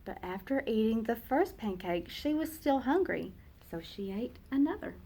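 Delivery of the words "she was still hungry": plain reading voice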